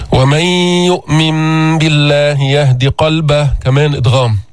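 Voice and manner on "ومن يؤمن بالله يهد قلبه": slow, measured tajweed chanting; Quran recitation. Shown as a demonstration. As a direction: recite carefully as a model for the class. Recites verse.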